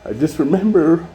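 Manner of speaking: fighting back tears as the memory hits; choked up. Real, not acted. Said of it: "get a little emotional".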